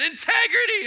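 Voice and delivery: very high, loud shout of Integrity; imagined yell. Retold or imitated. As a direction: shout Integrity as if waking the house. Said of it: "you just said".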